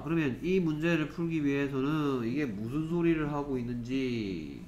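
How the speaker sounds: ordinary delivery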